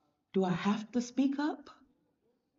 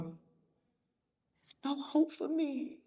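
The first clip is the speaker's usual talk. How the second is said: hopeless; 4 semitones higher, 3 dB quieter, much slower than usual. Acted. Voicing a discouraged follower.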